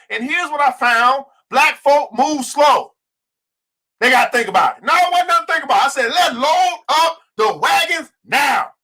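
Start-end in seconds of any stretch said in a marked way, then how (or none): 1.5-2.9 very slow, higher and pressed; preaching the point slowly; slow emphatic point. Real, not acted. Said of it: "preaching point". 4.8-8.7 high shouted rallying cry; fired-up call to load the wagons; preaching shout. Real, not acted. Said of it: "preaching shout".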